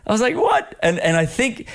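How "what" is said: squeaked in mock disbelief, way up high; stunned what. Host retelling his shock at being recognised, mock squeak. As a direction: jump right up into a falsetto of shock.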